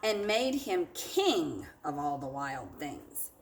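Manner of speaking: expressive picture-book read-aloud for a class; lively storybook narration; story reading to children. A narrator's reading. Teacher reads picture book aloud.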